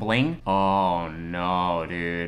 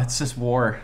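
ordinary talk, nothing marked

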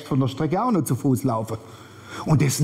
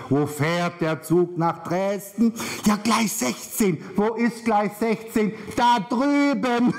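slow, drawn-out, high-pitched calling; exaggerated station exchange; 6 semitones higher, slower than usual. Traveler and station staff trading platform directions, slowly hollered. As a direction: stretch every word, building to a high holler.

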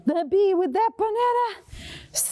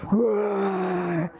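deep growling roar; lion roar; 9 semitones lower than usual. Shown as a demonstration. Teacher roaring with the kids as the lion. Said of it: "we need another big roar".

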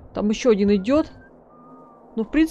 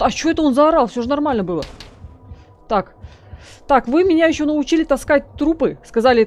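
usual speaking voice

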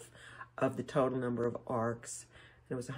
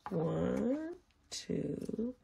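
ordinary delivery

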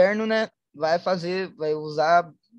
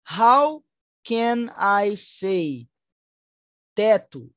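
drawn out, word by word; slow model phrase; 3 dB louder, much slower than usual. Shown as a demonstration. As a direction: stretch each word for the class. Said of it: "como é que pronuncia". (bem devagar e marcado)

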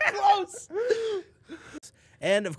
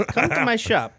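shopkeeper voice; 9 semitones higher, 3 dB louder than usual. Acted. Shopkeeper voice skit.

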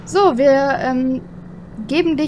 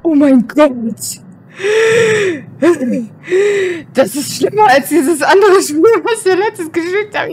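loud panicked whimpering; terrified, near tears; scared horror game streamer; 4 semitones higher, 4 dB louder than usual. Real, not acted.

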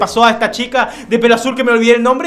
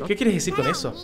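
soft, lower game-dialogue reading; lightly voiced siblings; 4 semitones lower, 7 dB quieter than usual. Brother questioning his sister about her secret, quietly voiced. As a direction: read it quietly, lightly in character.

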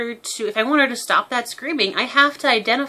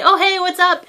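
cheerful greeting; 11 semitones higher, 9 dB louder than usual. Retold or imitated. Owner greeting bird back.

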